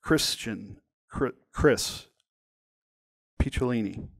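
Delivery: broken, halting self-introduction; acted nerves, stopping and restarting; nervous stammer. A narrator's reading. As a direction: stammer your own name in pieces. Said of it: "I stammered nervously into lukewarm, broken pieces".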